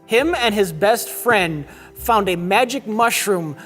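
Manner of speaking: ordinary talk, nothing marked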